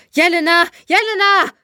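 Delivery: high, urgent calls of a name; alarmed calling. A narrator's reading. Vitali calls to Jelena after her scream, alarmed. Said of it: "rief er nur".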